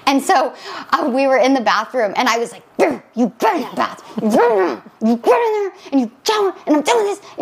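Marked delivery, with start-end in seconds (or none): 2.8-7.3 strained, growled mock scolding; whisper yelling. Mom reenacts ordering her son into the bath, whisper yelling. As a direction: scold fiercely while holding the volume down. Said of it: "I was yelling like that".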